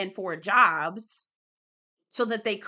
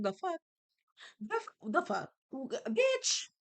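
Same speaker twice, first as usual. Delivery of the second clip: stunned disbelief; 5 semitones higher, 5 dB quieter than usual. Retold or imitated. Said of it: "I was like".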